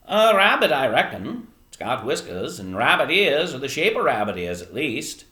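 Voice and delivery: put-on folksy old man sizing up a find; old fisherman, considering. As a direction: puzzle it out like an old sailor. Old fisherman examines Edward.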